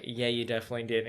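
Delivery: low, quiet, fast deadpan; low deadpan comeback; flat sarcastic retort. Retold or imitated. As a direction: mutter it with dry disbelief. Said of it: "And he's like".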